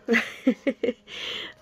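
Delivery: breathy run of giggles; amused laugh. Real, not acted. Fan reacting to a funny duo picture, amused laugh. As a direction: giggle helplessly at a funny sight.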